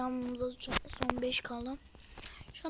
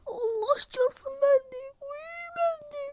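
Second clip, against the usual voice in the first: excited cry; 10 semitones higher, 5 dB louder than usual. Real, not acted. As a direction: cry out in delight. Still reeling from the pull. (sevinçle haykırarak)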